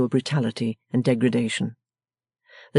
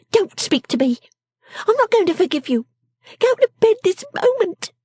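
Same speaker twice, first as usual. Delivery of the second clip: high, unforgiving scold; snapping; 4 dB louder than usual. A narrator's reading. Miss Wren refusing forgiveness, high snapping scold. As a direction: cut him off in a high, bossy voice. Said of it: "snapping him up".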